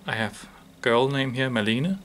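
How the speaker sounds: normal talking voice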